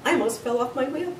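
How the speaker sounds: ordinary delivery, nothing marked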